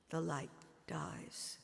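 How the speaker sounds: slow hushed whisper; whispered as the light dies. A narrator's reading. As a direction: let the words fall to a breath. Watching her son die.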